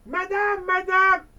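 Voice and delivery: ordinary delivery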